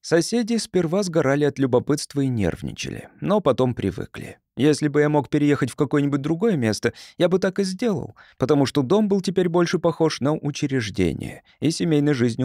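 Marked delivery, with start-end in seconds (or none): none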